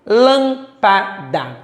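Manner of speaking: Lâmpada very slowly split LÂM, PA, DA; syllable by syllable. Shown as a demonstration. Teacher working the first example, syllable by syllable. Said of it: "separando-os em sílabas".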